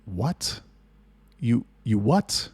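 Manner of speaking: retold disbelief, slow rising question; halting, stretched-out incredulous query. Reacting to the manager's admission, incredulous.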